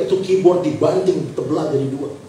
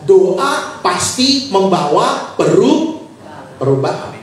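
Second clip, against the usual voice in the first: slow, drawn-out scripture emphasis; deliberate, measured preacher's recitation; emphatic preaching; 6 semitones higher, 4 dB louder, much slower than usual. Acted. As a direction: stretch each phrase for the congregation to follow.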